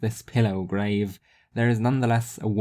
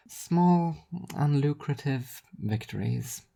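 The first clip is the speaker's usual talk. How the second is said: higher, slow, dry stress on Small; sardonic correction; 5 semitones higher, slower than usual. Acted. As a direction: correct yourself with a dry smirk. Rhea repeats with twist.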